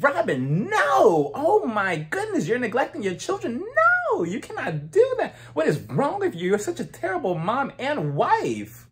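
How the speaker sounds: high, dramatic scolding; mock outrage. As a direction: ham up a shocked scold. Mocking Giselle scolding Robin.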